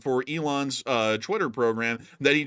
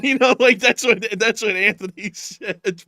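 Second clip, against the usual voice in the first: laughing; 9 semitones higher, 7 dB louder than usual. Real, not acted. Laughing after bit.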